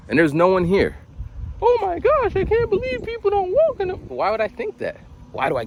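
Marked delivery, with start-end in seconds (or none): 1.6-3.9 squeaky falsetto mock outrage; mock shock. Mocking the reaction he never has, mock shock. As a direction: act scandalized in a very high voice.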